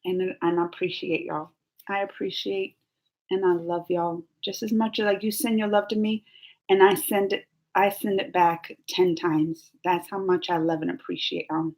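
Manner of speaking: soft, emotional thanks to viewers; grateful, close to tears; tearful thanks. Real, not acted. Vlogger thanking her fans, softly, near tears. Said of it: "I'm tearing up today".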